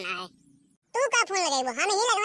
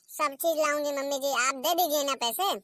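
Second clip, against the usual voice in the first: ordinary talk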